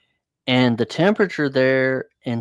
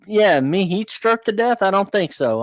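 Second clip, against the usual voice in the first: mock incredulous, pitched higher; sarcastic retort; 8 semitones higher than usual. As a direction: scoff at the idea, lightly. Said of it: "I'm like".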